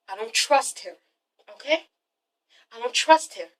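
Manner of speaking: hushed, halting acted admission; quiet distrust. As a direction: say it softly, with pauses. Acting German's grave admission.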